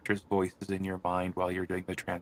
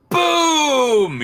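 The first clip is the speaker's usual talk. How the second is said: huge, high, drawn-out shout; explosive boom; 11 dB louder than usual. Acted. Translator reaching a two-page spread, explosive boom. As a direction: blast it out like an explosion.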